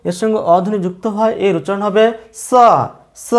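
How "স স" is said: "স" as a hissing dental s, "so"; tongue tip at the upper teeth roots for "স". Shown as a demonstration. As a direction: say "স" as "so", not "sho". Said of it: "জিহ্বার অগ্রভাগ উপরে পাটি দাঁতের গোড়ায়".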